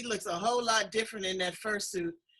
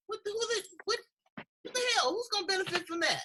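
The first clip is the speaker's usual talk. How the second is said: much higher, sputtering in mock disbelief; mock outrage; 10 semitones higher, faster than usual. Host to viewers scoffing at scripted shows, mock outrage. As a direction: fake indignation at scripted TV.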